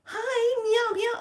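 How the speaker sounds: high cutesy meowing; cutesy cat voice. Retold or imitated. Imitating the cat greeting her, high and cute. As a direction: mimic the cat sweetly and high. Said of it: "coming out from the side of the bed like".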